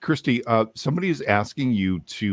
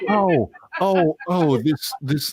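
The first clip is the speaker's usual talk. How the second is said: high-pitched mock Southern voice; hammed-up Southern accent; put-on Southern accent; 8 semitones higher than usual. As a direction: play a flustered Southern type, pitched high. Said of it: "we're still like this".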